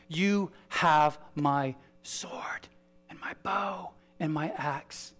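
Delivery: slow, hushed whisper; reverent whisper. Acted. The fellowship pledging to the ring-bearer, whispered. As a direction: breathe the pledge out slowly, full of awe.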